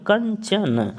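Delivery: Kanchan read slowly with a falling end; teacher reads Kanchan slowly for students to copy; slow reading demo. Shown as a demonstration. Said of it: "आप पढ़िए".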